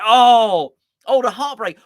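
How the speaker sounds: loud, high mock anguish cry; mock heartbreak. Presenter reading a gloomy score prediction, mock anguished wail.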